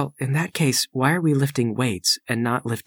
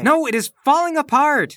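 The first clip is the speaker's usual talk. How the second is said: loud, high-pitched panicky retort; frantic, much higher and louder; exasperated outburst; 12 semitones higher, 7 dB louder than usual. Acted. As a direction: cry out in acted exasperation. Acted quarrel between cellmates.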